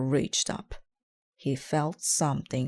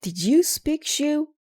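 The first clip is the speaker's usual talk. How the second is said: high, loud startled question; amazed the shoe talks; surprised; 12 semitones higher, 7 dB louder than usual. Acted. Detective startled by the voice, high and loud.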